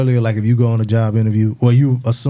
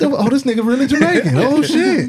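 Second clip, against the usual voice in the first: high shocked disbelief; realizing the truth, voice leaping up; 13 semitones higher than usual. Retold or imitated. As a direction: friend finds out he's truly Jamaican, high shocked.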